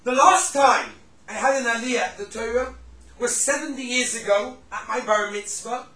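emphatic revelation. Retold or imitated. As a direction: punch out each phrase of the old man's disclosure. Retold old man reveals the reason.

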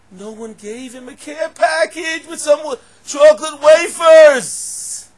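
high shout, stretched at the end; incredulous full-voice pulpit cry; preaching at full force. Acted. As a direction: build to a drawn-out holler.

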